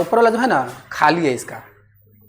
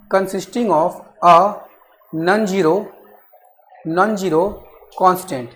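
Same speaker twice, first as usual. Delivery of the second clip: word by word, drawn out for students to write; slow dictation; 3 semitones lower, 4 dB quieter, much slower than usual. Acted. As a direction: dictate each phrase slowly and repeat it. Teacher dictating definition slowly.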